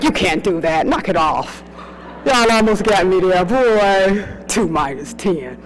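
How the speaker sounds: low, mocking voice calling out a trick; low drawling put-down of a trick question; mock scolding self-talk. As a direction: scold the test like a wise old hand. Mocking her own trick question.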